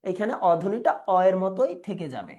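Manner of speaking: ordinary talk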